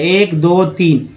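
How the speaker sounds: ordinary delivery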